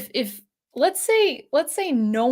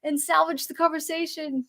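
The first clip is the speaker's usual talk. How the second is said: hurried fretting; 8 semitones higher than usual. Retold or imitated. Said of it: "I'm like".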